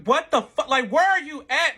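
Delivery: loud, high-pitched panicked outburst; retold panic. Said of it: "like, I'm panicking".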